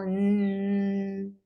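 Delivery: "n" as a held, voiced n sound; teacher hums "n" to show the vocal cords vibrate; voiced N demo. Shown as a demonstration. Teacher shows students a voiced consonant, held "n". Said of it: "yo digo".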